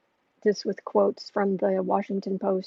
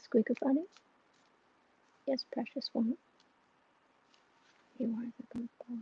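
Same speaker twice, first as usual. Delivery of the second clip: soft pet talk; 6 dB quieter than usual. Real, not acted. Owner cooing softly to her tired cat, hushed baby talk. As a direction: coo it softly like soothing a kitten.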